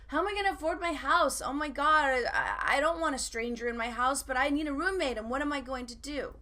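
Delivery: high, loud, rushed mock panic; financial fear. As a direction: spiral into hammed-up money panic. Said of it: "I went into financial fear".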